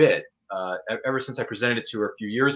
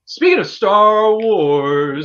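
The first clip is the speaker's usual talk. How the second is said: very slow, louder movie-trailer voice; dramatic slow announcement; 7 semitones higher, 11 dB louder, much slower than usual. Acted. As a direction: drag it out like an epic trailer. Dramatic Star Wars segue.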